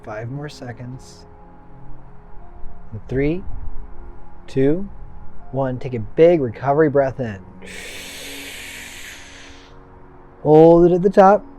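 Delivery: calm, firm breathing coach; guided breathwork cues. Acted. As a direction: count them through each breath.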